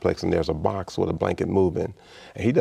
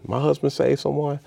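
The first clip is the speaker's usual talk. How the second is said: put-on surprised old woman; 8 semitones higher than usual. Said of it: "she's like".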